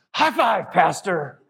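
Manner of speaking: high, loud celebratory call-out; exuberant cheer. Retold or imitated. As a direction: imitate an overjoyed man hollering. Man exults after receiving communion.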